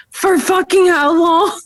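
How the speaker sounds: high, slow horrified question; mock horrified. Imitating boyfriend horrified at a baby's diaper, slow. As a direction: stretch it out in mock horror. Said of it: "And he's like".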